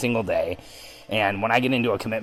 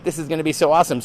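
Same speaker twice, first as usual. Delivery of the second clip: excited; 6 semitones higher, faster than usual. Retold or imitated. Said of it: "it's like".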